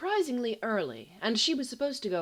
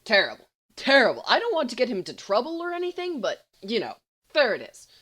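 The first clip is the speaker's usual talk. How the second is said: quick, loud, much higher gushing fib; gleeful fast lie; 4 semitones higher, 8 dB louder, faster than usual. A narrator's reading.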